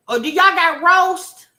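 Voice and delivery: high, loud clueless question; hammed-up woman asking; high mock woman. Voicing Wineglass.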